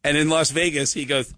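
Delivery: ordinary talk, nothing marked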